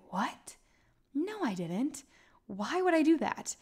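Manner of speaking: hushed gasp rising to a high squeak; shocked disbelief. Retold or imitated. Shocked at one-person booking claim.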